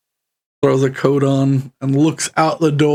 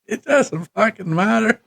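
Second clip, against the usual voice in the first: higher, mildly fed-up; resigned npc; 7 semitones higher than usual. Acted. GM voicing the npc, mildly fed up with the wet shoes. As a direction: give up on the shoes. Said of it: "He's like".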